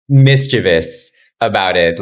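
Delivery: ordinary delivery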